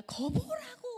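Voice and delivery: high, sulky "거보라고" thrown out; a prophet's peevish I-told-you-so; annoyed Jonah. Retold or imitated. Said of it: "요나 짜증납니다". (짜증 난 요나의 높고 토라진 "거보라고")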